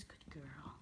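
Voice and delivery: soft, breathy whisper of praise. Real, not acted. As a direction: whisper it gently to the pet. Praising the pet softly.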